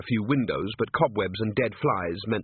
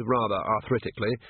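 usual speaking voice

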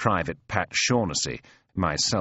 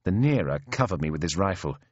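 plain reading voice

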